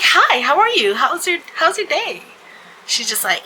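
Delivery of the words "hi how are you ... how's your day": high, loud customer-service cheer; extra-friendly. Retold or imitated. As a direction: greet a rude customer with over-the-top sweetness. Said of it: "I did the extra".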